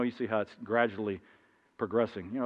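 ordinary talk

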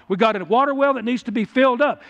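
ordinary delivery

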